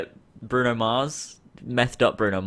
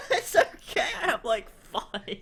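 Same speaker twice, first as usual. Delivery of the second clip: high, breathless words through giggles; laughing repetition; 9 semitones higher than usual. Real, not acted. Friend repeating the woman's line, breathless laughter. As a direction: echo the quote while cracking up. Repeats quote while cracking up.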